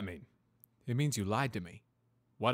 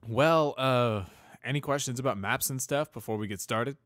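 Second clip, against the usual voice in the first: flustered fumbling; 5 semitones higher, 10 dB louder than usual. Acted. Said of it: "fumbled over his answer".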